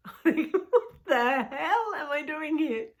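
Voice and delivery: high, slow, laughing disbelief; laughing at herself. Retold or imitated. Telling the story of his silence, laughing in disbelief. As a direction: laugh it out in mock panic. Laughing at her own panic.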